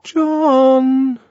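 high, drawn-out coaxing call; high, wheedling little voice; small ingratiating voice. A narrator's reading. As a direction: call the name timidly through a door. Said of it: "ingratiating voice from the bathroom".

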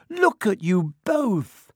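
high, drawn-out shriek. A narrator's reading. Hermione seeing both boys tangled, slow alarmed shriek. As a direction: shriek in alarm, stretching the words. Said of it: "shrieked Hermione".

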